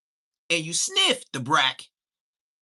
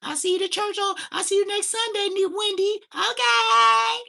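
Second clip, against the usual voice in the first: imitating mom; 5 dB louder than usual. Mom voice continues at church.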